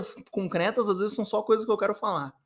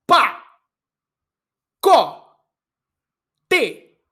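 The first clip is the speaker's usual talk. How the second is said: cada sílaba gritada, separada e alta; 7 semitones higher, 11 dB louder, much slower than usual. Pretend. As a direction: grite cada sílaba como um golpe. (sílabas de pacote gritadas em conflito)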